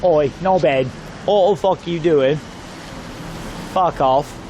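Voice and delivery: lightly put-on London accent. Shown as a demonstration. Streamer showing viewers his practised London accent, lightly put on. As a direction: have a go at a rough London accent. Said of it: "working on my London accent".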